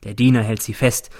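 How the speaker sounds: usual speaking voice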